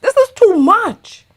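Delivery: high-pitched "This is too much"; exasperated outburst. Real, not acted. Exasperated reaction, then repeated lower.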